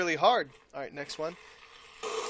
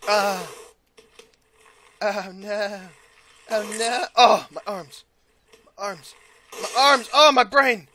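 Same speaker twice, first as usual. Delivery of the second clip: mock anguish; 4 dB louder than usual. Game character losing limbs, mock pain.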